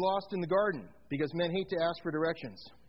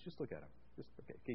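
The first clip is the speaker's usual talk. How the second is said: hushed aside; 7 semitones lower, 15 dB quieter, much faster than usual. Real, not acted. Aside while people check shoes.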